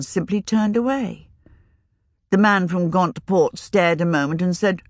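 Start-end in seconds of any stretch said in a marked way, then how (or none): none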